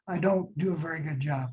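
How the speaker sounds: ordinary talk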